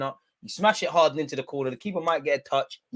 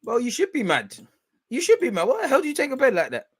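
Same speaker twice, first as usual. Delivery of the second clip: mock telling-off; 9 semitones higher than usual. Mock scolding the penalty taker.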